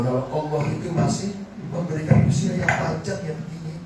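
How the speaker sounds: usual speaking voice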